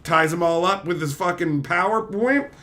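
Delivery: ordinary talk, nothing marked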